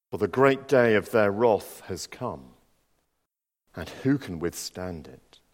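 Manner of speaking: solemn, measured Bible reading; scripture reading. Acted. Preacher reading Revelation to the congregation, solemn. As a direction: read the Revelation passage with weight. Reads scripture aloud in church.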